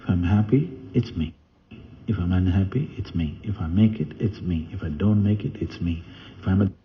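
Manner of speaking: soft repeated refrain of owning it all; inner affirmation. Acted. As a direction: quietly recite it like a vow to yourself. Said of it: "You say".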